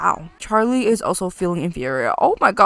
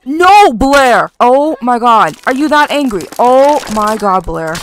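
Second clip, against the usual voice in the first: loud, startled exclamations; alarmed outcry; 3 semitones higher, 8 dB louder than usual. Real, not acted. Gamer scolding Blair's outburst, loud and alarmed. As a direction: cry out in real dismay.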